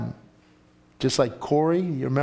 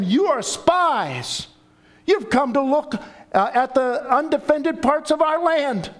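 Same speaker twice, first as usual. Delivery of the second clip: stern accuser; 11 semitones higher than usual. Acted. Joseph accuses his brothers harshly.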